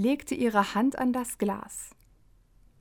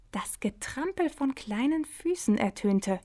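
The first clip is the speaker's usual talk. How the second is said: softer, voiceless whisper; whispered narration; 3 semitones higher, 4 dB quieter than usual. A narrator's reading. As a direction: hush it like a secret. Erzählerin flüstert Geräusch. (geflüsterte Erzählung)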